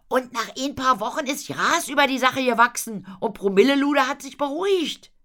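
high, loud, insistent arguing; Paule's eager voice. Acted. Paule argues for the trip. (hohe, laute, drängende Stimme)

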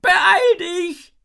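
very high, loud, frantic screech; shrieking. A narrator's reading. Jin hurries Isabel as the ice grows, shrieking. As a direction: screech it out in panic. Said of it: "kreischt Jin".

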